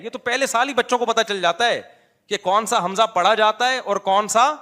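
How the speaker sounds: normal talking voice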